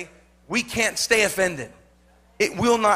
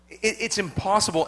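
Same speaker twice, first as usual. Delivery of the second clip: usual speaking voice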